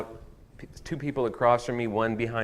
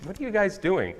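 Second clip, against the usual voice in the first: mock puzzled question, higher, through laughter; laughing; 8 semitones higher, 4 dB louder than usual. Pastor retells his confusion to church, laughing and high. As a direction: laugh while asking. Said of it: "I was like".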